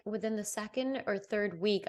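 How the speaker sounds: ordinary talk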